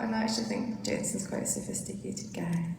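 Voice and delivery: hushed aside to the crowd; confiding whisper. Real, not acted. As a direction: let them in on a secret. Confiding aside about the director.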